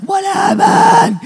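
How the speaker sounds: high, loud, slow What happened; shouted question. Retold or imitated. Crowd yelling back at the risk manager, high and loud. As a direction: yell out, stretching it. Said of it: "People are like".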